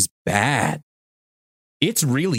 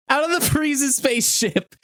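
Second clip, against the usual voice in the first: laughing; 14 semitones higher than usual. Real, not acted. Laughing while retelling Vegeta.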